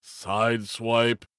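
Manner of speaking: slow weak stunned stammer; stammered weakly. A narrator's reading. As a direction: stammer the name slowly, dazed. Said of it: "Alcahest stammered weakly".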